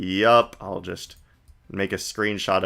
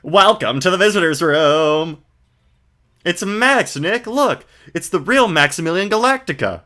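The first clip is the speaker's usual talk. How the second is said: put-on higher, brighter girl voice; Maya voice; 7 semitones higher than usual. Streamer voicing Maya to the viewers, girlish and high. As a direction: play an eager young girl.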